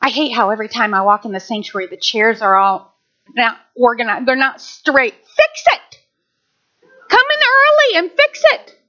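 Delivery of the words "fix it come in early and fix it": urgent command. Real, not acted. Preacher urging congregation to act.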